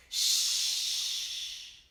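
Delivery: shushing. Shown as a demonstration. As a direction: hush them gently. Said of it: "I want to tell those crazy monkeys".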